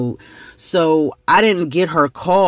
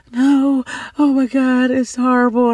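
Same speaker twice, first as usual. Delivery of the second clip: acted tearful lament, pitched up; mock bawling; 8 semitones higher than usual. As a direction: imitate a distraught friend crying. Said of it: "she's bawling".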